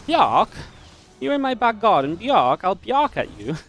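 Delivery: high, slow, pompous posh dog. Pretend. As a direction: play a snooty posh dog. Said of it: "like a very posh dog".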